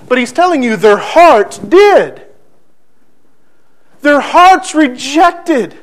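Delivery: loud, emphatic sermon build; forceful preaching. Real, not acted. Preacher driving the point home to the congregation, forceful.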